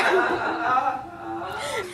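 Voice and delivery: quiet breathy giggle fit; soft laugh. Real, not acted. Reader giggling mid-story, soft laugh. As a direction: laugh it off under your breath.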